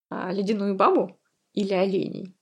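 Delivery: usual speaking voice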